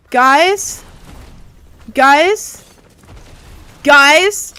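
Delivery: high, loud, questioning call; alarmed calling out. Real, not acted. Player calling to teammates on the battle bus, alarmed calling out. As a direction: call out to the squad, voice rising each time.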